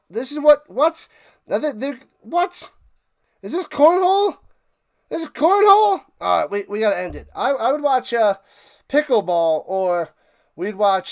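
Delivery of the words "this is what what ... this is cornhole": can't believe what's on the screen; rising astonished shout, louder; incredulous disbelief. Real, not acted. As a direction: pitch shooting up high and loud in disbelief. Disbelief at cornhole on TV.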